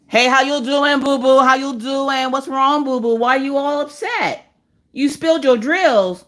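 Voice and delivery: put-on high, cooing baby talk. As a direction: soothe a toddler. Babying upset customer.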